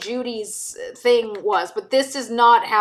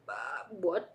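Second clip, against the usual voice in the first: puzzled listeners; 4 semitones lower, 12 dB quieter than usual. Retold or imitated. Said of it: "they're just like".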